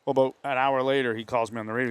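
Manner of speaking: ordinary talk, nothing marked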